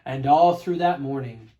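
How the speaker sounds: ordinary delivery, nothing marked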